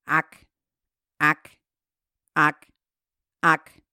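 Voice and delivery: croaked frog calls, low. Acted. Frogs calling out at the pond, croaking. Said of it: "blieben aber bei ihrem".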